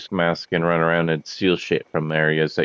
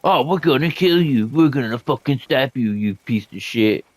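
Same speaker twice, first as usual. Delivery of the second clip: mock enemy threats; 6 semitones higher than usual. Retelling game enemies' trash talk, hammed-up higher threats. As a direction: ham up the bandits' trash talk, slowing at the end. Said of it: "they're just talking shit".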